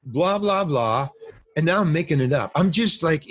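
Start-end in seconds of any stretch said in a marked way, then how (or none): 0.0-1.1 high sing-song blah-blah that drops away; mock line reciting. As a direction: recite filler lines in mock delivery. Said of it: "So I go".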